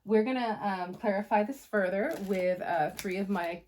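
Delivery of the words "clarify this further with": normal talking voice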